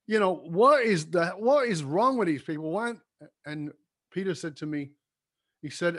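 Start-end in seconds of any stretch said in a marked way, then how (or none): 0.1-2.9 exasperated. Retold or imitated. Retelling his frustration to Peter.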